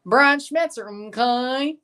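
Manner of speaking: put-on high voice with rising tag; Mister Mackey. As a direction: mimic a cartoon counselor, lifting the final okay. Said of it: "reminds me of Mister Mackey".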